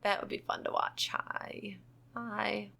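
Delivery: soft unvoiced whisper. Real, not acted. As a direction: breathe it out in a whisper. Whispering aside.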